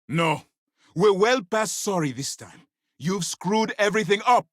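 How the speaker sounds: commander's heated rebuke; angry reprimand. Acted. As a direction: tear into a subordinate who botched it. Commander scolds subordinate for failure.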